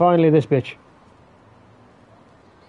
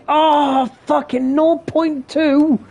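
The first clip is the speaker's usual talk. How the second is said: dismayed cry; 11 semitones higher, slower than usual. Real, not acted. Bad dart score, dismay.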